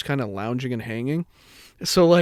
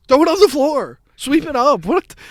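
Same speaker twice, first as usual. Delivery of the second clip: high-pitched exasperated orders; exasperated scolding; 11 semitones higher, 4 dB louder than usual. Retold or imitated. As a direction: call out orders in mock outrage. Acted scolding of Eli.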